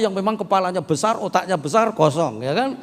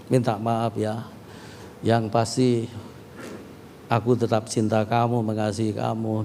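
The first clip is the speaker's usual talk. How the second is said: soft, lower, gentle apology; tender apology; 6 semitones lower, 4 dB quieter than usual. Retold or imitated. Husband hugging his wife and apologising, soft and tender. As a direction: hold her and say sorry softly. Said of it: "Aku langsung peluk dia loh".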